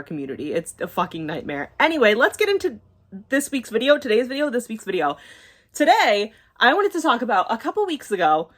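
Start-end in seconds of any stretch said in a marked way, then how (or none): 1.8-4.5 abrupt switch to a bright, higher youtuber intro voice; aggressive tone shift. Real, not acted. To her viewers, a sudden bright channel-intro tone. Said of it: "the very aggressive tone shift". 5.7-8.5 bright intro voice. Real, not acted. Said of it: "the very aggressive tone shift".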